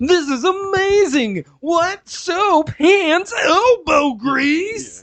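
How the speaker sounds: high, loud, drawn-out fake amazement; over-the-top streamer. Mimicking a streamer's video for kids, wildly over the top. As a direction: shriek every phrase in mock ecstasy. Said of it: "Yeah, over the top".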